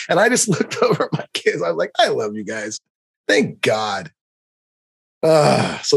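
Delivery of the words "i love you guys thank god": lower, softer grateful aside; soft relieved love; tender. Retold or imitated. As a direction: murmur it fondly to your kids. Tender aside to own kids.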